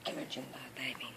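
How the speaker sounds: soft whispered coaxing; whispering. Real, not acted. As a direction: whisper to the dog. Coaxing a dog gently.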